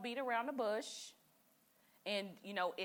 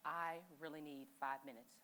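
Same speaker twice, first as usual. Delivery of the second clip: slow, much softer plea for space; quiet blunt request; 7 semitones lower, 9 dB quieter than usual. Retold or imitated. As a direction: ask for space, slow and near whispered. Asking partner for space.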